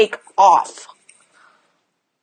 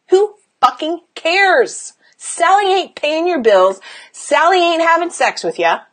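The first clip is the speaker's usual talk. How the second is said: high, emphatic brush-off; exasperated, punch it out; scornful dismissal; 6 semitones higher than usual. Real, not acted. Coach telling client to ignore the critic, scornful dismissal. Scornful answer to mocked worry.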